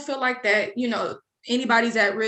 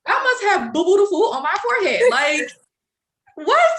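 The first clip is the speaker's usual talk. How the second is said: indignant outrage; 8 semitones higher, 5 dB louder than usual. Retold or imitated. Indignant at being falsely accused.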